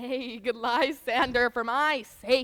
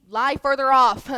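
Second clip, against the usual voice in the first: firm command; 9 dB louder than usual. Acted. Hermia repeats her demand louder.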